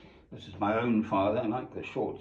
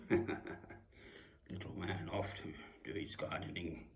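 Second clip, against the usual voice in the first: slow, hushed whisper; 3 semitones lower, 9 dB quieter, slower than usual. Real, not acted. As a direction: breathe it softly, slow. Fond photo commentary, hushed.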